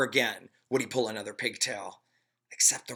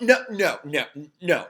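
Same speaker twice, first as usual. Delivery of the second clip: refusing; 5 semitones higher, 8 dB louder than usual. A narrator's reading. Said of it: "he thought".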